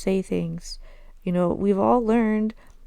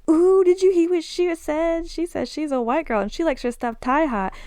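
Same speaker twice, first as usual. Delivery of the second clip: put-on gossipy tattling girl; 9 semitones higher, 3 dB louder than usual. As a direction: mimic a girl gleefully spreading gossip. Girl mocking tattling to others.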